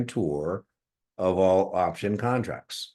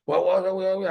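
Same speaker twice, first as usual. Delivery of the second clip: higher, louder mock alarm, hammed up; mock panic; 11 semitones higher, 4 dB louder than usual. Mocking test takers freaking out over a rule change, hammed-up panic. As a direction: imitate panicking traders crying whoa. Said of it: "everybody was freaking out, going".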